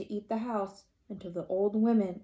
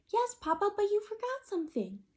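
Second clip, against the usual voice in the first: put-on high, small child chick voice; piping little chicken voice; 11 semitones higher than usual. Little chicken asking Papa for a story, high childlike voice.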